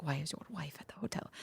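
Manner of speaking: hushed whisper. Retold or imitated. Retelling Carla confronting Guido, whispered. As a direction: drop to a whisper for the jealous line.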